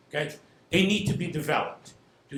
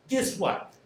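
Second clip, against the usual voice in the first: ordinary talk, nothing marked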